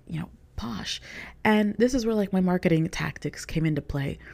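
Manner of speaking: ordinary talk, nothing marked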